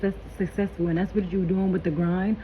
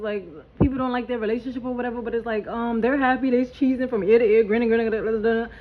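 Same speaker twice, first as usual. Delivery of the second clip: ordinary delivery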